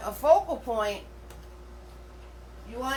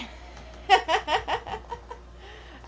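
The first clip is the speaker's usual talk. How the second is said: laughing; 11 semitones higher than usual. Real, not acted. Laughs about little sleep.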